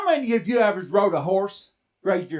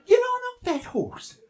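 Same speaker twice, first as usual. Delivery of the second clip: high mock order. Grandma ordering boy.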